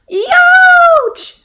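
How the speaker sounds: high piercing yelp of pain; scream. Acted. Roxy screaming after biting the prickly pear. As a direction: shriek as the spines stab. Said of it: "screamed Roxy".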